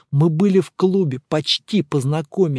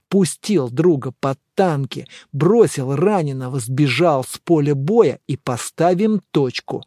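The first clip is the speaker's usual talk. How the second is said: ordinary delivery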